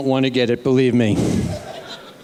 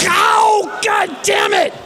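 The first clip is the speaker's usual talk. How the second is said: high, loud cry of pain; mock agony, yelling high and slow; 4 dB louder, slower than usual. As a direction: scream through the stabbing. Being stabbed again.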